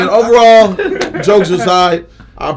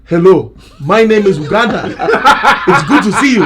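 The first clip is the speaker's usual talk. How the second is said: hammed-up African accent, pitched up and loud; 4 semitones higher, 4 dB louder than usual. Joking lineup of Africans introducing themselves, mock African accent. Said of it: "Speaking in English with the accent".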